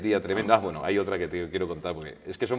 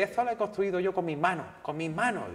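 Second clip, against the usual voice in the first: put-on Andalusian accent; 9 semitones higher than usual. Flamenco singer scoffing at reading to the reporter, Andalusian accent. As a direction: scoff it in a rough Jerez Andalusian accent, pitched up. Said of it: "con su acento así".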